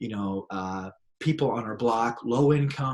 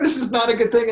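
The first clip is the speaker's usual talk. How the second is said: much higher, louder, half laughing; laughing mock panic; 12 semitones higher, 8 dB louder than usual. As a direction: play comic dread. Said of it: "I'm like".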